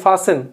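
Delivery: FASTEN said as FAH-sen; silent T. Shown as a demonstration. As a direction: drop the T in FASTEN. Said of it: "టీ పలకొద్దు".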